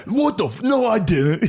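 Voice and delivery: spaz out denying it; flustered denial. Retold or imitated. Bartender caught out, denying in a high panic. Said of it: "He, like, spazzed".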